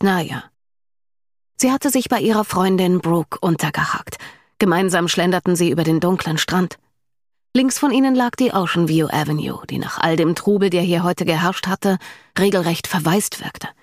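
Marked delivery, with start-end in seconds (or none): none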